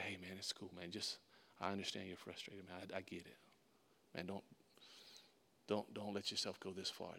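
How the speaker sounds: hushed, low calming voice; real soft. Retold or imitated. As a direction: talk an angry man down very gently. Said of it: "I stayed real soft".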